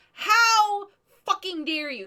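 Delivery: mock outrage. Collector retells her outburst at the rival, mock outraged. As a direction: drag out the outrage for laughs. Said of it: "and I'm like".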